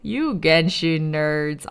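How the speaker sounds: low, slow teasing jab; drawn-out dry disdain in a low voice; deadpan mock scolding. Mock scolding of fellow fans, first take.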